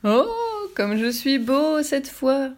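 high, slow, admiring voice; wolf delighted with his peacock feathers, slow and high; proud wolf. A narrator's reading. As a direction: preen in front of the mirror.

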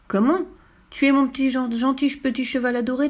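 high-pitched, pleading disbelief; feigned shock. Acted. Fifi acts shocked at threat. (indignation feinte, voix aiguë)

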